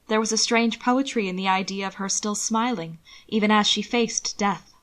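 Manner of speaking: ordinary delivery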